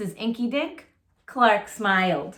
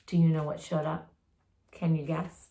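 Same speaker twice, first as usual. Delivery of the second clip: plain reading voice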